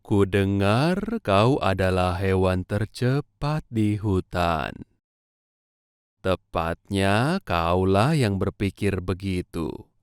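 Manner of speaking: usual speaking voice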